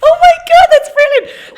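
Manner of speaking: high, loud burst of real delight; delighted amazement. Real, not acted. User hearing the assistant pull off the accent, delighted amazement. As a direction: exclaim it with a laughing squeal.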